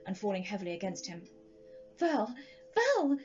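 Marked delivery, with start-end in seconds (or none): 2.0-3.2 high, loud desperate calling of his name; despairing cry. A narrator's reading. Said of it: "she cried despairingly".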